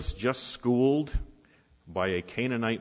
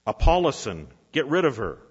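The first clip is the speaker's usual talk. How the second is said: loud, higher dismissive holler; hollering back; 4 semitones higher, 6 dB louder than usual. A narrator's reading. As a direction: shout her away. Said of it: "the disciples are hollering back".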